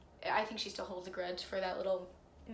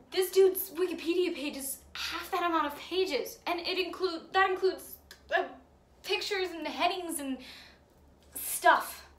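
higher, louder, indignant rant; worked-up, incredulous complaining; exasperated rant; 5 semitones higher, 6 dB louder than usual. Acted. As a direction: rant in disbelief at the injustice. Exasperated rant about essay length.

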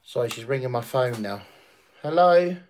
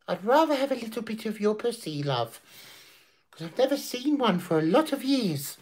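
higher, lightly put-on dirty old man; mock old man; 8 semitones higher, 4 dB quieter than usual. Grandad answering the mocked woman, light put-on leery voice. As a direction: play the leery grandad, half-joking.

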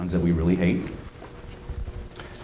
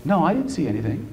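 higher-pitched defensive denial, acted; lightly voiced questioned maid; 8 semitones higher than usual. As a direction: play a questioned maid denying it. Acting questioned maid denying theft.

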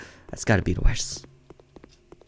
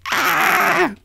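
a much higher hammed-up shriek; high mock shriek. Gamer pretending the manager chases him, high mock shriek. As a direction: squeal like you're being chased, in fun.

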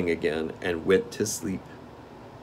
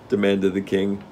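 ordinary delivery, nothing marked

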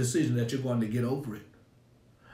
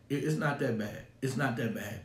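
ordinary delivery

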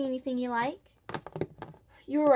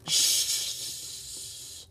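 shushing; 4 dB quieter than usual. Acted. Hushing near the creatures.

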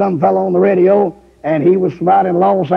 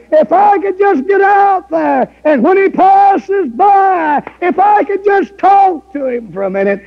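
preaching at full force; 12 semitones higher than usual. Acted. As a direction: cry out the longing from the pulpit.

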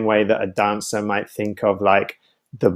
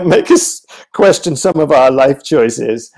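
chuckling through the words; laughing; 4 semitones higher, 5 dB louder than usual. Real, not acted. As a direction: laugh it off as a self-mocking joke. Wry joke about lockdown life.

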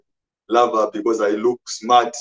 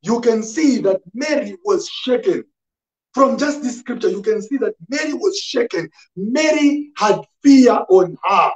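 high-pitched, insistent sermon delivery; raised pulpit voice pressing the point home; impassioned preaching; 11 semitones higher than usual. Real, not acted. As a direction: preach it urgently, building by repeated phrases. Preaches to congregation.

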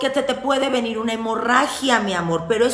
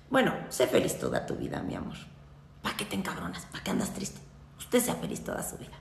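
whispered, much softer mock sermon; 14 semitones lower, 12 dB quieter, faster than usual. Witch to followers, whispered advice to be happy. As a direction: breathe it like a sweet secret.

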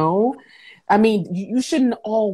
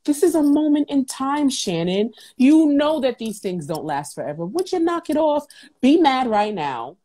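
stern self-talk; 7 semitones higher than usual. Retold or imitated. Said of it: "I had to tell myself that".

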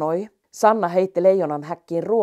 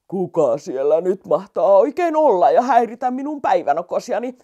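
louder, altered grumbling lion voice; grumpy old lion; 6 dB louder than usual. Acted. Old lion woken by the mouse, grumbling louder. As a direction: grumble as a lion disturbed from his nap.

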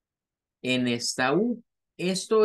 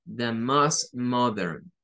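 The most modern, slow and clear, stress on most; slow model phrase; 4 semitones lower, much slower than usual. Shown as a demonstration. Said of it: "lo más moderno". (frase modelo lenta, acento en most)

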